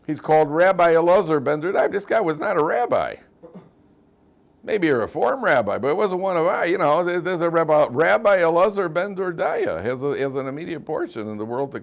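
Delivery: high and loud, amazed and insistent; excited emphasis. Real, not acted. Teacher marvels that the sinner got called rabbi, excited. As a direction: push it up with astonishment.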